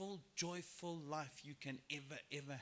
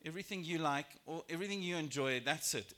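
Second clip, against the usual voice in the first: normal talking voice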